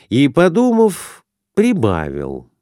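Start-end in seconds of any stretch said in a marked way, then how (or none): none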